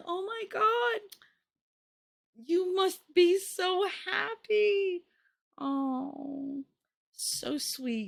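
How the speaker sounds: high, slow put-on sweetness; fake high-pitched gushing. Lying to a new mother about her baby, high and slow. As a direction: gush way up high, drawing it out. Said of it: "my voice goes really, really high".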